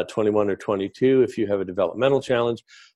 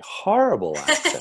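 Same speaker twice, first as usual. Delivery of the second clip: "Horrible" leaping up high in mock disgust; emphatic disdain; 11 semitones higher than usual. Real, not acted. Guest repeats his verdict on New Jersey accents, emphatic.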